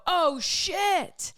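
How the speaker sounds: sudden high yelp of alarm; startled curse. Retold or imitated. Retelling her cartwheel, a high startled cry. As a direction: blurt it out high in mid-cartwheel. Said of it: "you can hear me go".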